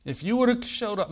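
high, loud complaint to Jesus; indignant acted reproach; mad Martha. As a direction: scold him, pitched well up. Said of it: "she's kinda mad".

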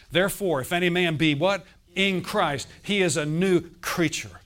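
loud, building sermon cadence that hammers each phrase; full-force preaching. Acted. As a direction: preach it out to the whole room.

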